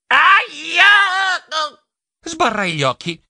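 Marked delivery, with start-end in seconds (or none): 0.1-0.8 high, loud ouch; pained yelp. Acted. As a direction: yelp from a chipped tooth. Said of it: "lanciai un urlo".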